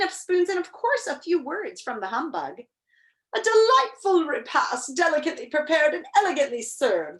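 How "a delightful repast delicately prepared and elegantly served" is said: loud, high, self-important proclamation; announcing. A narrator's reading. Humbug praising the banquet to no one, grand announcement. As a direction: declare it grandly to nobody in particular. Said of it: "he announced to no one in particular".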